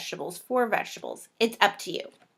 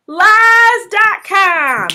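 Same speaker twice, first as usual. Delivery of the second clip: very slow, loud, high accusation; called out; 11 semitones higher, 14 dB louder, much slower than usual. Acted. Calling out fake fasters, very slow loud. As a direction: drag out each word loud. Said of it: "I have one little word for you".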